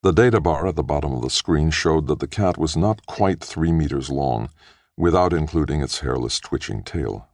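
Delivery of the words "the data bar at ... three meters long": usual speaking voice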